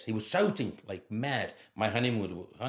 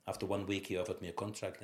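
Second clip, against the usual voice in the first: ordinary talk, nothing marked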